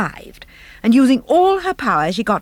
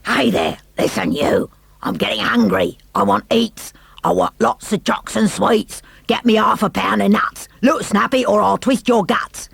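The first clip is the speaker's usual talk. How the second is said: tummy beast voice. A narrator's reading. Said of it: "It shouted".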